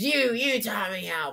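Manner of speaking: a hint of a whiny kid, drawn out; kid's reply. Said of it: "the kid turns to his dad and says".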